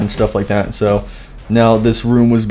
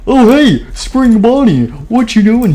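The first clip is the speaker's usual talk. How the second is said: excited greeting; 11 semitones higher, 4 dB louder than usual. Acted. Fredbear greets his rescuer.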